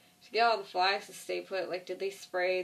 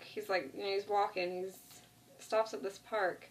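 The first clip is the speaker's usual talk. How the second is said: normal talking voice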